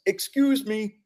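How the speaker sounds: high, loud put-on character voice; voice from the crowd. Somebody in the crowd pushing through, high put-on voice. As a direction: pitch it way up and call out. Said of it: "person who comes from a crowd and says".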